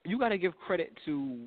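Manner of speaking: normal talking voice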